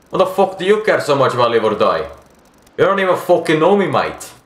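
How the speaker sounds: indignant Australian character voice; Australian accent. Acted. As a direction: push them away as a bitter Australian. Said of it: "trying to do a fucking Australian accent".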